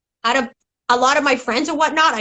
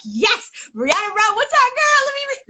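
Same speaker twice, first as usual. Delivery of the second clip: excited to read; 11 semitones higher than usual. Retold or imitated. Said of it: "I'm like".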